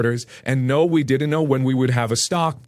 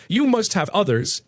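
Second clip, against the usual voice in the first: higher-pitched insistence; pushy, pitched-up customer; insistent; 6 semitones higher than usual. A narrator's reading. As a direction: insist there must be more. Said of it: "she insists".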